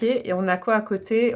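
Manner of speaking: ordinary talk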